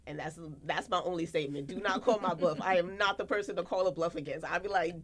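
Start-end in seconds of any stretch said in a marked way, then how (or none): none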